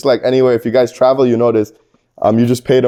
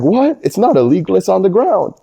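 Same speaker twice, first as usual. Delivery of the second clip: high, mock-innocent disbelief, rising at the start; incredulous protest; 7 semitones higher than usual. Telling Thai cops he found them on the ground, mock-incredulous and high. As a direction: play dumb and squeak out the protest.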